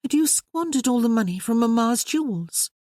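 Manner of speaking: a sister asking her brother, pointedly; young sister Maria. Acted.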